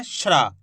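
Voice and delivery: श्रा as sh and r blended into one syllable; blended shra. Shown as a demonstration. Teacher explains the mix of two letters, blended shra. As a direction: model श्रा, sha running straight into ra. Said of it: "शा और रा का मिश्रण है".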